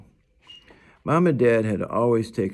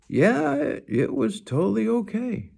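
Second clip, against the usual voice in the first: put-on boy's voice. A narrator's reading. Boy voiced reassuring dad.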